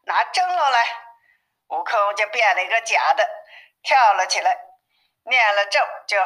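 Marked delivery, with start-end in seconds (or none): 0.1-1.0 a lightly raised call to the minions, pitched up; a demon calling his underlings, mildly raised; mock call to the little demons. A narrator's reading. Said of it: "喊".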